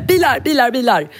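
put-on high eager little kid chant; car-obsessed toddler. Leo always going on about cars, high excited child voice. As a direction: chirp it like a boy who loves cars. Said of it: "Alltså alltid så här".

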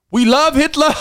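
very high, loud cartoonish cheer. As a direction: yell it out in mock jubilation. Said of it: "turning up at the [name] interview ... going".

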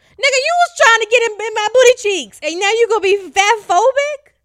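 high, loud, indignant yell at him; outraged shouting retort. Retold or imitated. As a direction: blast him, way up and loud, in disbelief. Telling off her pervert ex.

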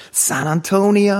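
high, slow, drawn-out mock stage shout; mock rock yell. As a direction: call it out like a hard-rock frontman between songs. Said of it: "skrek han så här". (härmat, högt och utdraget scenrop)

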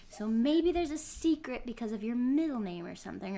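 normal talking voice

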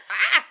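short, loud, high yelp; hammed-up startled yelp; mock yelp. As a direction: yelp in surprise. Character yelps when blocked.

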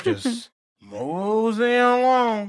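slow amused drawl; lazy mosey. Retold or imitated. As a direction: stretch it like a carefree stroll. Joking about long walk.

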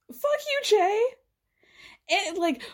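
high squeaky fuck you; exasperated outburst. Real, not acted. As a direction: fling it at him in disgust.

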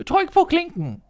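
high, loud call through the door; grandmother calling. Acted. Grandmother calls the wolf in, high and loud. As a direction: shout it out from the bed. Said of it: "råbte bedstemoren".

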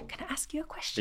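high, soft Geordie imitation; Geordie accent. Drama teacher questioning her pupils, imitated Geordie accent. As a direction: put on a quiet, high-pitched Geordie voice. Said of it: "would just speak Geordie".

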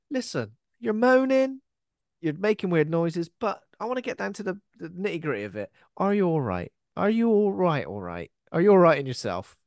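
mock stern cop. Mock cop addressing caller.

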